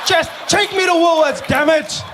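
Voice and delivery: exasperated passenger shouting at nagging GPS; frustrated shout. Retold or imitated. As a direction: lose patience and shout the order.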